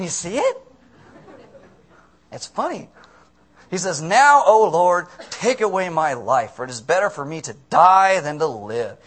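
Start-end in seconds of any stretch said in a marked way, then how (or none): 4.0-9.0 loud, drawn-out melodramatic plea; overemotional junior high girl. Retold or imitated. Preacher as Jonah begging God to take his life, melodramatic. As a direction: overact it like a hopped-up teen. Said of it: "like a junior high girl all hopped up on emotion".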